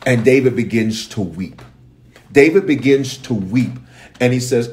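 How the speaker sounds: usual speaking voice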